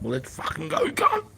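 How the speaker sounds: high, loud rallying cry, the last word yelped; fired-up rally. Retold or imitated. Corporal cheering the recruit back on his feet, fired up. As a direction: pump him up, let's go.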